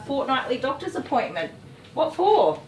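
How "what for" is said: exasperated. Real, not acted. Exasperated rhetorical question, loud.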